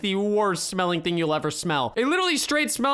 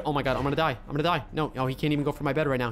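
ordinary talk

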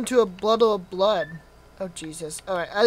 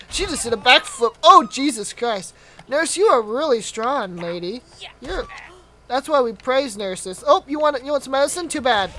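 ordinary talk, nothing marked

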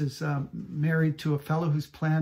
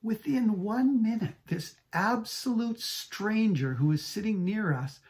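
ordinary talk, nothing marked

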